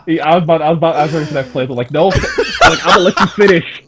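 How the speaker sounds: loud and fast, laughing between words; excited laughing. Real, not acted. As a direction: rush it out, amused and fired up.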